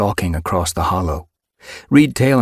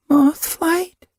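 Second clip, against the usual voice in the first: dazed kit voice. Acted.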